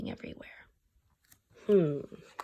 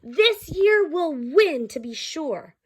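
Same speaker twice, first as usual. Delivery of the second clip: cheering crowd; 8 semitones higher than usual. Acted. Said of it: "everyone shouted and cheered".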